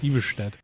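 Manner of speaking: Iversted said with an sh sound, Norwegian style; Norwegian pronunciation. Shown as a demonstration. Streamer correcting himself, Iversted the Norwegian way. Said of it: "not Ivorsted".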